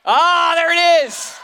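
loud, high sudden delight; light up as the slide finally appears; pleased surprise. Real, not acted. Preacher greets the slide with a loud delighted cry.

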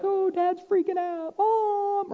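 hammed-up child crying out for mom; scared kid. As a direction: whine like a kid running to mom. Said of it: "And they're like".

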